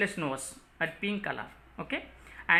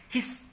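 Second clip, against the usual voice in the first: soft unvoiced hiss like a snake; 6 dB quieter than usual. Shown as a demonstration. Teacher showing class a snake's hiss. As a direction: breathe the word out as a snake's hiss. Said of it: "Hiss means the sound of snake".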